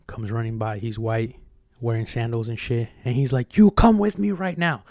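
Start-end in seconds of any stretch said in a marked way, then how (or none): 3.6-4.8 high, loud barked order; commanding shout. Retold or imitated. Old man shouting at the young marine, commanding. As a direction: order the scared kid inside, loud and high.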